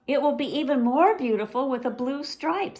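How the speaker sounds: big sister talking little sister into paint; coaxing big sister. Acted. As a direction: sell the idea sweetly. Zelda persuading.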